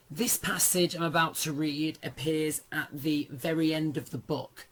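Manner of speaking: normal talking voice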